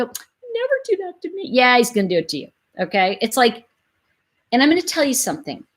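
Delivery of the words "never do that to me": higher, softer dreamy naive voice; mock naive hope. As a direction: sigh it like a smitten woman. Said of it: "and we go".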